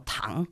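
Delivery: "tang" low and dipping; third tone TANG. Shown as a demonstration. Student demonstrates Chinese tones, "tang" dipping low. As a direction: sink "tang" down like a surprised question. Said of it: "tercer tono".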